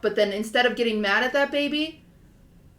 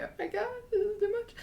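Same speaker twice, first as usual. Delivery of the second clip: much higher, tearful crying voice; 12 semitones higher, 8 dB quieter, faster than usual. Retold or imitated. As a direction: cry it out, overwhelmed by the cartoon. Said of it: "I start crying".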